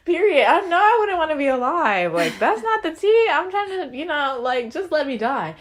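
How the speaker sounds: lightly hammed-up diva comeback, easing off at the end; sassy retort. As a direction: imitate a sassy character defending herself. Acts sassy robot defending pulling plug.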